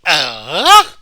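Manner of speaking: loud startled yell of dismay; mock scream. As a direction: yell it like you can't bear to watch. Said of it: "I usually just go".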